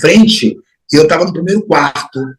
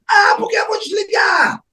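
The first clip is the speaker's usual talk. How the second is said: put-on high-pitched shout, retelling a teen's outburst; yelling back; 5 dB quieter than usual. Teenage son yelling back at his mother about the light switch, shouting. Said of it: "não grite comigo".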